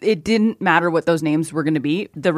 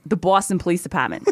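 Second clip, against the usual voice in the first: Boston accent, aw vowels, dropped Rs. Shown as a demonstration. Demonstrating the perfect phrase in a Boston accent. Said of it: "bit of New York twang".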